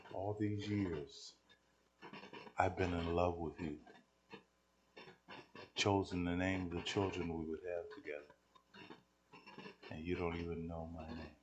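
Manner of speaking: soft and low, with long pauses; quiet confession. Retold or imitated. Young lady confesses her love to the preacher, quiet.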